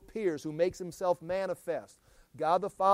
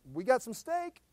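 host offering steak; 8 semitones higher than usual. Pretend. Acted Abraham answering.

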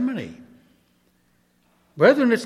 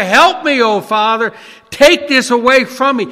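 loud, higher begging prayer; anguished plea; 4 semitones higher, 7 dB louder than usual. Retold or imitated. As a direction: cry out to the Father for help. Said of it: "groveling before the Father".

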